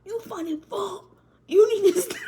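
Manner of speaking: high, loud laughing scold; giggling mock insult. Real, not acted. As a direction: crack up while scolding.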